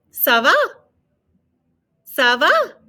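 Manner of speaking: "Ça va?" then "Ça va" as answer; model phrase. Shown as a demonstration. Teacher models asking and answering, clear French. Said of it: "response is".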